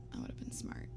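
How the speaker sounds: whispered self-rebuke; soft whispered afterthought. Real, not acted. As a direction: whisper it under your breath. Unvoiced self-scolding aside.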